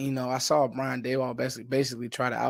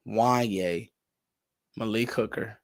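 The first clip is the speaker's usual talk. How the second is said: ordinary talk, nothing marked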